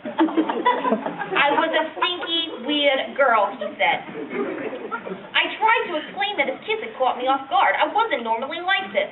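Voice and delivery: put-on reluctant, embarrassed old lady reminiscing; old woman. Old lady telling a young interviewer about down there, embarrassed old-woman voice. Elderly woman's monologue after plain introduction.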